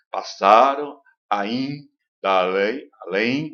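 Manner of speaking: very slow, "além" drawn out nasal; nasal vowels shown. Shown as a demonstration. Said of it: "O en, né?".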